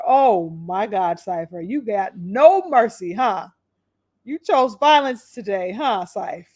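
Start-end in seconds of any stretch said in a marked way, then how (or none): none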